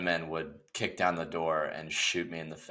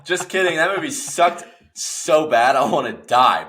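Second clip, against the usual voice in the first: loud, high, laughing rant; half-laughing, emphatic complaint; exaggerated outburst; 8 semitones higher, 7 dB louder than usual. Real, not acted. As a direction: drop the politeness and blurt it out loudly. Blurted reversal after polite praise.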